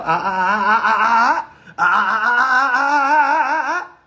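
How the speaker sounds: loud gleeful laughter; laughing. Real, not acted. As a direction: laugh out in triumph. Laughing triumphantly.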